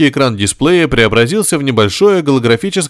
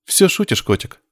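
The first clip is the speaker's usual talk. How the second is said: absent-minded; 9 semitones higher than usual. A narrator's reading. Nurse answers patient on her way over, mechanically. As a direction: toss the pet name off unthinkingly. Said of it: "машинально ответила Мария".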